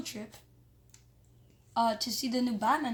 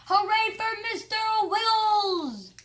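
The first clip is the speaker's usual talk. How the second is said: much higher, loud, slow cheer; wild cheer; 11 semitones higher, 7 dB louder, slower than usual. Acted. Crowd going crazy for the ferret, high loud cheer. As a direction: scream the cheer wildly.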